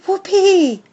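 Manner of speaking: high, loud whoop of delight; a dog's happy shout; joyful whoop. A narrator's reading. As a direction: let out a wild cheer. Dog thrilled about car ride.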